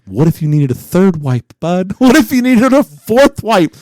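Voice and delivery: building to a loud, high comic protest; incredulous challenge. Retold or imitated. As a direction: act out mounting disbelief at a buddy. Incredulous challenge to buddy, escalating.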